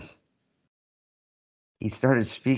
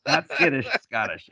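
laughing correction; 3 dB louder than usual. Real, not acted. Storyteller confirms co-host's wordplay, laughing. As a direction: laugh through "not skittish", settle on "Scottish". Echoing the contrast while laughing.

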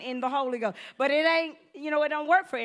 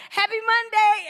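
chirpy coworker; 6 semitones higher, 8 dB louder than usual. Retold or imitated. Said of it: "'cause they be like".

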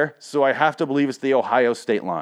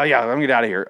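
ordinary talk

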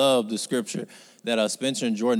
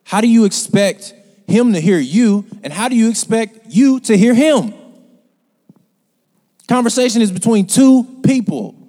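loud, high, impassioned preaching; fiery preaching; 9 semitones higher, 10 dB louder than usual. Acted. Preacher scolding the congregation for not listening. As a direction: preach it hard, calling them out.

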